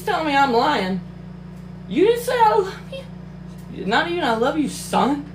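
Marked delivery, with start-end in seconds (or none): 1.9-3.9 real indignant cry; outraged disbelief. Real, not acted. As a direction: wail it in mock heartbreak. Outraged at missing love you.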